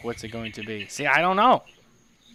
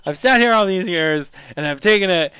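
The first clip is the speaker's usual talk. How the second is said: higher, louder mock outburst; mock snapping; 5 semitones higher, 6 dB louder than usual. Imagining Mitchell snapping at the teasers, mock angry outburst. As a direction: play the pushover finally blowing up, hammed up. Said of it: "Mitchell will snap and he'll just be like".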